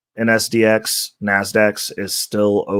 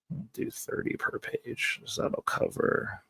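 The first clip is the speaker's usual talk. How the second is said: whispering to self; 13 semitones higher, 13 dB quieter than usual. Real, not acted. Muttering while planning.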